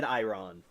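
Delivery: Iran said eye-RON; habitual pronunciation. Shown as a demonstration. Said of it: "I always said".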